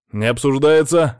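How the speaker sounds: firm, loud ruling; no-argument command; cutting off. A narrator's reading. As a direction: shut down the debate, slow and emphatic. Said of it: "отрезал я".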